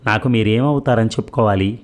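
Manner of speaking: ordinary delivery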